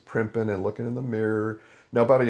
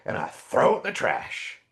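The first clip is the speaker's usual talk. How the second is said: sarcastic; 8 semitones higher than usual. Retold or imitated. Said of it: "I'm getting sarcastic".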